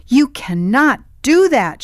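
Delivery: high, loud shriek. A narrator's reading. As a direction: shriek it in shock and panic. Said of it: "she shrieked".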